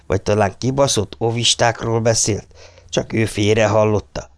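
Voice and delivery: plain reading voice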